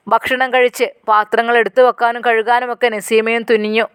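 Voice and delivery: usual speaking voice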